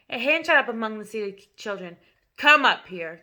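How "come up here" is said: loud, curt order; loud command. A narrator's reading. Headmistress ordering the boy onto the stage. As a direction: summon him sharply. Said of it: "the Trunchbull announced".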